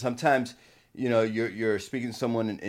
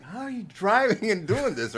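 amazed question; 11 semitones higher than usual. Retold or imitated. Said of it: "I'm like".

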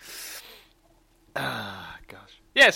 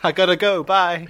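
quick, loud sign-off; hurried goodbye; 4 semitones higher, 8 dB louder than usual. Pretend. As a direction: rush off the phone. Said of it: "And then hang up, quick".

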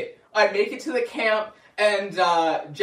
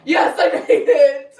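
higher, much louder, slow laughing "yes"; triumphant; 9 semitones higher, 11 dB louder than usual. Retold or imitated. Learning he is on time, triumphant and laughing. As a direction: celebrate making it.

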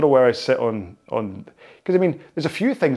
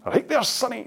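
put-on much higher Glasgow drunk in a Scottish accent; 12 semitones higher than usual. Vlogger voices a Glasgow drunk in a Scottish accent. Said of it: "drunk in the corner of Sauchiehall Street".

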